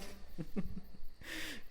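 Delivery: shrieking cackle, very high; high maniacal laugh. Acted. Torturer cackling over his bound victim, a maniacal laugh.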